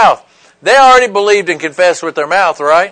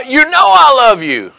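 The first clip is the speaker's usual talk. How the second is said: high, heated imitation of Peter; exasperated, ready to cuss; 8 semitones higher than usual. Preacher acting out Peter answering Jesus, exasperated. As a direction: act out fed-up protesting at being doubted. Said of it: "he was ready to cuss again".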